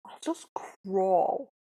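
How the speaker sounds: slow, drawn-out mock crawl; stretched-out, lounging imitation; lazy echo. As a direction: drag each word out lazily. Co-host echoes the messenger's lazy line.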